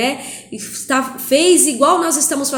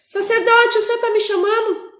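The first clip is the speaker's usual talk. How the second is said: put-on young Samuel asking; 11 semitones higher, 4 dB louder than usual.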